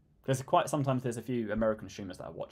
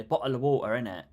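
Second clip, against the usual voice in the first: mocking British Cockney, water with a dropped T, louder; mock British accent; 6 dB louder than usual. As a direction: do a cheeky London "Bottle of water, innit". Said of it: "chat shit about British people and they say".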